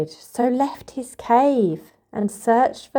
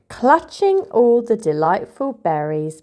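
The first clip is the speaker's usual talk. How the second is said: ordinary delivery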